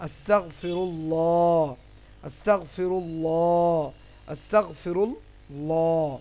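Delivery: আস্তাগফিরুল্লাহ drawn out slowly, full dark L and long "law" ending; heavy Lam pronunciation. Shown as a demonstration. Teacher models what we must say, আস্তাগফিরুল্লাহ with heavy long Lam. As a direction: stretch the end of আস্তাগফিরুল্লাহ into a thick, rounded "llooh". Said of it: "এবার থেকে আমাদেরকে কি বলতে হবে".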